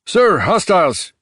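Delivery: loud, high alarm call; called warning. Acted. Young cop calling his boss about zombies, loud. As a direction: shout it out to the boss. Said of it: "Herrera called".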